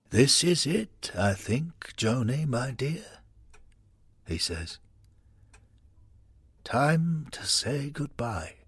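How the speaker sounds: put-on slow, faint farewell of a frail old man; dying old man. Giancarlo on his deathbed saying goodbye to his wife, slow and faint. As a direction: let each word cost him breath.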